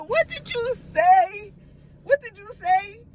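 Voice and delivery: a mother asking her son what he just said, incredulous and high; stunned mom. Retold or imitated. Mom confronting son's remark.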